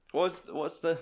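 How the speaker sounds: usual speaking voice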